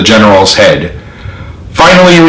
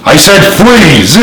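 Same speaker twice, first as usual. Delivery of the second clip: angry command; 5 semitones higher, slower than usual. A narrator's reading. Repeated command with gun.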